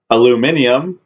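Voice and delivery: aluminium said "al-u-MIN-i-um", every letter sounded; spelled-out pronunciation. Shown as a demonstration. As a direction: read aluminium just as it is spelled. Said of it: "it's spelled aluminium".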